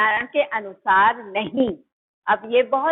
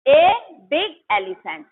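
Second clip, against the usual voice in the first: a big elephant drawn out very slowly and a bit louder; slow clear example; 4 dB louder, much slower than usual. Shown as a demonstration. Teacher dictating an example to students, slow and clear. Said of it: "क्या है?".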